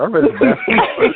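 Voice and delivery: laughing. Real, not acted. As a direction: laugh through the son's words. Father retells son, laughing.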